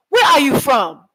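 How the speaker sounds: high, loud blunt question; locals asking. Retold or imitated. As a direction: fire the question straight out. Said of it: "That's how they ask me".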